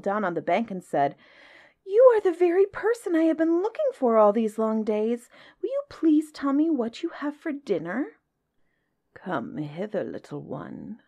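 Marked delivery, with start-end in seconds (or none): 1.8-8.2 high, eager little elephant; breathless. A narrator's reading. Elephant's child finally meets the crocodile, breathless and eager. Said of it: "grew all breathless and panted". 9.1-11.0 soft, coaxing crocodile. A narrator's reading. Crocodile lures the child to his mouth, softly. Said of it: "said the crocodile".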